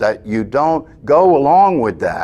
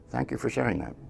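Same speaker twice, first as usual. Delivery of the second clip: soft, gentle thank-you, much quieter; quiet, patient reply to the mind; calm dismissal; 12 dB quieter, faster than usual. Retold or imitated. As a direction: kindly let the thought go. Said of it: "you say".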